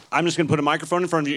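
ordinary delivery

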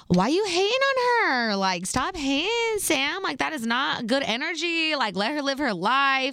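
high-pitched, exasperated pleading; mock exasperation. Girlfriend silently telling boyfriend to stop hating, exasperated. Scolding him in her head.

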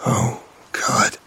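whispered gasp of dread; breathless whisper. Acted. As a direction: gasp it out under your breath. Narrator collapses to the floor.

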